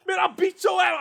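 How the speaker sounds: high, loud threat; angry shout. Acted. Fox and raccoon start fighting, angry shouting. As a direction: yell it like a brawler.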